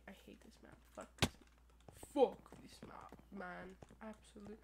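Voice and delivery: whispered, annoyed curse at the map; fed-up whisper to himself; muttered curse. Real, not acted. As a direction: hiss it under your breath. Raging quietly at the map.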